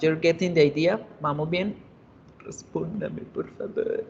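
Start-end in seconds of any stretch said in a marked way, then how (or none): 2.4-4.0 whisper. Acted. Teacher pleading for class answer.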